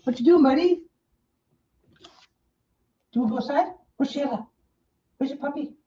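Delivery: calling for Bruno the puppy, sing-song; pet talk. Real, not acted. Talking to the dog.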